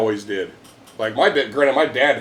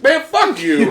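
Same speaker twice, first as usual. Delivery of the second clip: high, mock indignant cry; mock outrage; 10 semitones higher, 3 dB louder, slower than usual. Retelling his mock fury at lucky Bobby, high indignant cry. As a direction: fake fury at a lucky friend.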